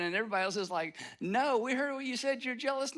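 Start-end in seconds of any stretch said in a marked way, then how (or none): 1.2-3.0 higher-pitched mock accusing retort; acted insistent pushback; calling someone out. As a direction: play the crowd contradicting an excuse. Acting out everybody's retort.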